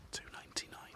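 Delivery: a sly whispered price; whispered aside. Real, not acted. Owner confides the bed's cost in a whisper. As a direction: hide the cheap price in a whisper.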